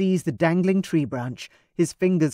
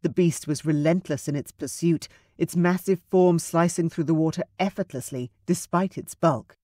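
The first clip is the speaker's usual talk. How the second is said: ordinary delivery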